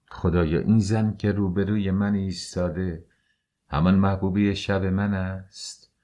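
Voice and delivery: usual speaking voice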